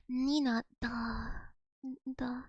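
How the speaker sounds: "Nina, da" in a low, soft Russian accent; Russian mode. Shown as a demonstration. Switching into Russian mode to sound properly Russian. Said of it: "Russian mode on".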